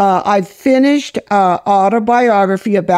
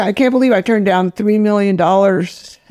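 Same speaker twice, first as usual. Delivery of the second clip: ordinary delivery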